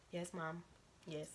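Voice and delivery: small, low, very soft voice; retold meek admission; sheepish reply. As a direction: answer meekly, almost under the voice. Sheepish answer to mom's scolding.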